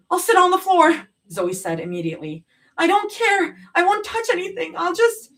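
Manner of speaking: high, loud rushed begging; desperate bargaining; frantic pleading. A narrator's reading. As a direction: beg the medic. Begging to ride along.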